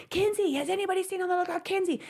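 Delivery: high, fast mock yell without the volume; retold frantic yelling. Mom sprinting up floors for lost Kinsey, imitated high frantic yelling. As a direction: imitate a panicked parent calling out. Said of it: "I'm yelling".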